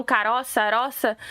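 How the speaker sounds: imitating rural southern Brazilian colony accent; lightly mocked interior gaúcho accent; German-Italian colony accent. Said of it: "eles falam".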